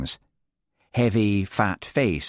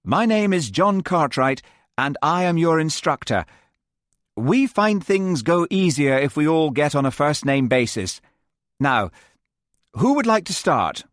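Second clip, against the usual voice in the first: eager instructor; 7 semitones higher, 3 dB louder than usual. Acted. John introduces himself to class.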